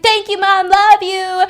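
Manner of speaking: put-on high, loud, gushing child voice; thrilled little boy thanking mom; excited kid. Seven-year-old son thanking his mother, overjoyed.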